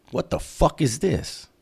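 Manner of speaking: high-pitched indignant question, slowed down; pissed disbelief. Retold or imitated. Inmate looking down at the piece he was handed, pissed. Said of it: "Night Owl was pissed".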